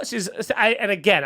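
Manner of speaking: ordinary talk, nothing marked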